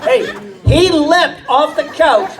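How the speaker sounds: usual speaking voice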